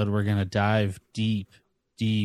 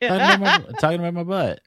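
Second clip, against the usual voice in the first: loud; 9 semitones higher, 9 dB louder, faster than usual. Real, not acted. Joking loudly about his butt.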